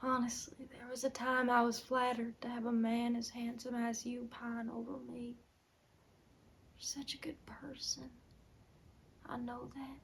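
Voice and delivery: very soft, fading to a whisper; hushed confession. Acted. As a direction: confess softly, almost to yourself. Tender confession to husband.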